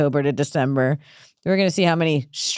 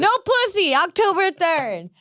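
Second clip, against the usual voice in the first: high slogan, stretched at the end; mock rally slogan; 10 semitones higher than usual. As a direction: call it out to a crowd. Proclaims the mock ban slogan.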